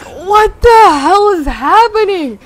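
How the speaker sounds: high, loud and slow; drawn-out stunned outcry; shocked disbelief. Retold or imitated. Narrator reliving seeing his friend hit a pole, stunned.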